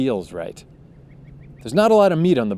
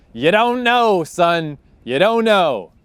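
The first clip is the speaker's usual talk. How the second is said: higher, louder jab, "You don't know, son"; talking down to them with a raised voice; scornful shout; 6 semitones higher, 5 dB louder, slower than usual. Real, not acted. Ranting at fans of performance.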